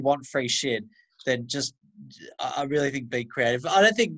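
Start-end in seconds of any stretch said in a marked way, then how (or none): none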